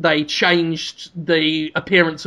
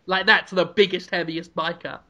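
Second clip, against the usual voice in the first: ordinary delivery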